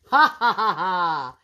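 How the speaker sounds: big booming mock laugh from the giant; hammed-up giant's laugh; roaring giant laugh. A narrator's reading. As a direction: throw back your head and roar a greedy laugh. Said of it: "he roared".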